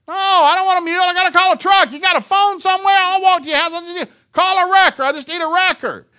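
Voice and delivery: high, loud frantic holler; exasperated yelling. Retold or imitated. Stranded coach refuses farmer's mule, exasperated yelling.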